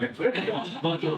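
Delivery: a quick real laugh; little amused laugh; short laugh. Real, not acted. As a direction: laugh briefly at yourself. Laugh mid-story.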